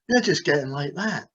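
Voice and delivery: ordinary talk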